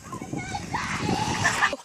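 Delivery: high laugh. Real, not acted. Friend wiped out in race.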